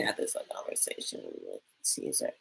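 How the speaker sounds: barely-mustered, very quiet hungover mumble; Maria. Acted. Host imitating a woman who partied all night, barely audible. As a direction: imitate someone too wrecked to speak up, almost voiceless. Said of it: "it's very low and she can barely muster".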